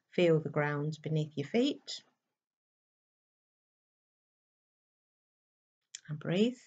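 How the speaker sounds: soft, slow meditation voice with long pauses; guided meditation. Acted. Guiding listeners to close their eyes and breathe, soft meditation voice. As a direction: ease them gently into stillness, leaving space to breathe.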